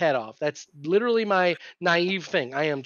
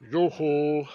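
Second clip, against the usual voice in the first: deep singer voice; 3 semitones lower than usual. Retold or imitated. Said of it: "that deep voice singer".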